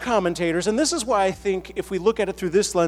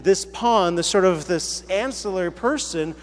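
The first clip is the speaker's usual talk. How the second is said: normal talking voice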